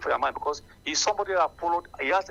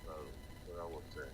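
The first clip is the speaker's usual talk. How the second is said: barely audible; 11 semitones lower, 19 dB quieter, much slower than usual. Real, not acted. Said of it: "Your voice is very low".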